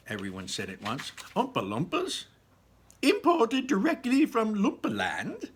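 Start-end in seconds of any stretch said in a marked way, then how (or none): 1.3-5.5 high, loud, proud showman voice; proud Mister Wonka. A narrator's reading. Wonka proudly presenting his workers to the guests, high showman voice. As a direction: announce it with showman's pride, pitched high. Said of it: "said Mister Wonka proudly".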